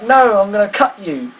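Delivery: high, loud mock threat; hammed-up furious mum threatening the nurse; raging threat. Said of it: "She was raging".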